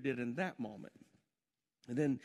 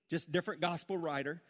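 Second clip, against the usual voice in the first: usual speaking voice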